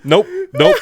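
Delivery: high, loud, laughing disbelief; scoffing through laughter at the movie; laughing mock outrage. Reacting to a slave claiming freedom, laughing outrage.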